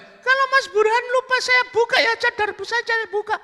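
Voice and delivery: loud, very high falsetto; protesting woman. Acted. Woman to the man who forgot her, protesting falsetto.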